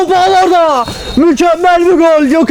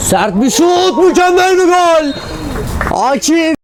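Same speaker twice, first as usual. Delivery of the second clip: screaming football commentator; 4 dB louder than usual. Real, not acted. Goal call by commentator.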